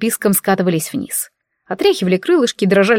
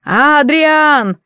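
shouted name, higher and louder; calling out; 6 semitones higher, 3 dB louder than usual. Acted. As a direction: call down into the crevice. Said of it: "кивнул принц и закричал".

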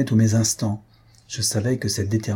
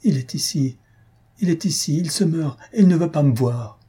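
higher, urgent narrated outcry; distraught cry; 5 semitones higher than usual. A narrator's reading. Faustine rushing into Mario's arms, pale, distraught cry. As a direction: cry it out in distress, haltingly at first. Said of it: "se jeta dans mes bras et s'écria".

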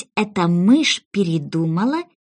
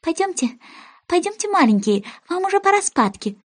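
high, trembling canary call; higher, quavering call to her chicks; trembling little voice; 10 semitones higher than usual. A narrator's reading. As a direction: gather the chicks nervously. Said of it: "дрожащим голоском позвала".

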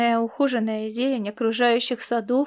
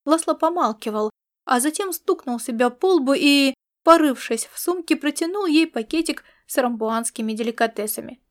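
ordinary delivery, nothing marked

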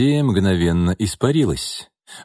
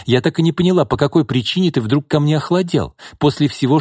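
upset woman; 7 semitones higher than usual. A narrator's reading. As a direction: press him for an answer, hurt. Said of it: "воскликнула Бакшеева".

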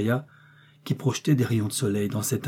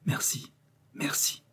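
very soft, weak thanks; faint, fading voice; barely audible; 9 dB quieter, slower than usual. A narrator's reading. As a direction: say it with hardly any strength left. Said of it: "eut à peine la force de dire".

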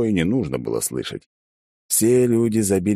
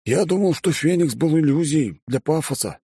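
amazed; 9 semitones higher than usual. A narrator's reading. Guest voiced, amazed.

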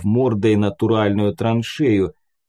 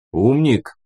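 calling out. A narrator's reading. Calling out to the creature.

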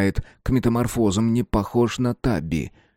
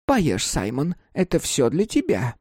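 coaxing; 6 semitones higher than usual. A narrator's reading. Beep urges Simon to eat.

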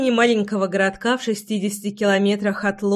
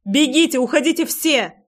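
urgent shout; 5 semitones higher than usual. A narrator's reading. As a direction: call out to the guards in alarm. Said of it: "кричала она".

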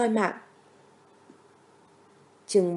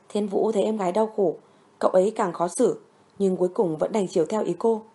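plain reading voice

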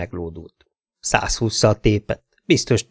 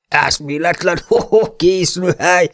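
higher, mocking cop laughing through the words; mocking laughter; 7 semitones higher than usual. Bill the cop mocking the detective, laughing. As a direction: chuckle at the fool. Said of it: "fűzte tovább Bill".

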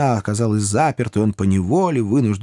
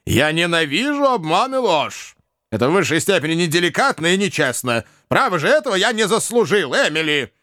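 reproachful father; 8 semitones higher than usual. A narrator's reading. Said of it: "укоризненно сказал".

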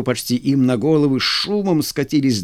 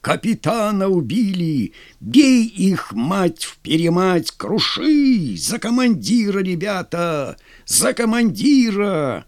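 high desperate battle yell; desperate shout; 5 semitones higher than usual. Acted. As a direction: yell in despair and fury. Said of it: "отчаянный крик". (отчаянный крик)

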